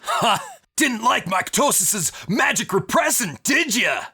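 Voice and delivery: high, loud mocking villain voice; gloating villain. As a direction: taunt them like a cocky brute. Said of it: "kinda looks over Vic at you and laughs".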